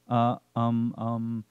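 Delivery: halting, tongue-tied uh and um; a flustered young man fumbling for words; nervous muttered stammer. A narrator's reading. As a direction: stammer as if words won't come. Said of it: "is all he could mutter out".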